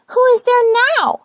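high, loud call through the door; little rosebud demanding who knocks. Acted. Rosebud calls out.

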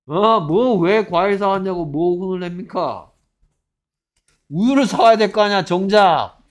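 loud, incredulous telling-off; exasperated scolding. Real, not acted. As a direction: berate the class over something obvious. Teacher berates students over wrong answer. (어이없어하며 큰 소리로 다그치듯)

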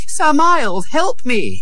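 put-on high-pitched boy's call; high cry for help, moderately loud. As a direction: call urgently to a rescuer. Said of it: "he cried".

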